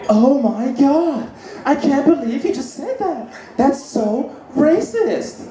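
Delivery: high, slow, scandalised lady voice. Retold or imitated. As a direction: clutch your pearls, drawn out. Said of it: "she was like".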